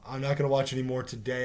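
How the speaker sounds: ordinary delivery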